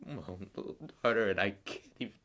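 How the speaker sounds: loud, breaking grief, breath giving out at the end; anguished outburst. Acted. Father anguished over his own little daughter, voice breaking. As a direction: burst out, then choke on the last words.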